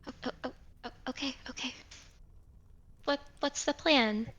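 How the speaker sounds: hushed, breathless whisper. Acted. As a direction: drop to a scared whisper. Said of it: "do not raise your voice".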